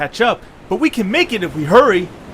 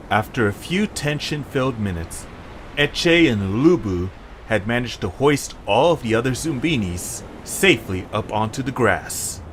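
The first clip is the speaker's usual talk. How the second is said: story narration; 6 semitones lower than usual. A narrator's reading. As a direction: narrate the scene calmly. Game narration between voices.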